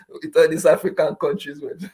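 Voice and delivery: talking through real laughter; amused, barely getting it out; laughing. Real, not acted. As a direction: let giggles break into the words. Laughing through his own joke.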